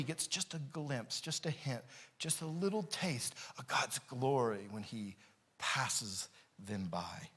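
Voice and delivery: almost whisper it in awe; hushed awe. Acted. Preacher hushing the congregation in awe.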